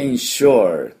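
slow "insure" with weight on SURE; teacher's stretched demo of "insure"; English pronunciation. Shown as a demonstration. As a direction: model "insure" with a drawn-out r ending. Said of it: "こんな感じです".

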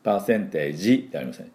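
normal talking voice

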